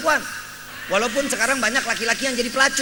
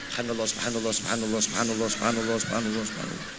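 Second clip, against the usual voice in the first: much lower, drooling murmur of subhanallah; ogling man muttering; 13 semitones lower, 6 dB quieter, faster than usual. Retold or imitated. Preacher mocks a man eyeing women, low drooling mutter. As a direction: mutter it deep like a man staring at a pretty woman.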